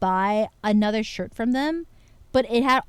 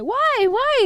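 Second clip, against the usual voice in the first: high mock pleading; 13 semitones higher, 5 dB louder than usual. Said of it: "I'm like".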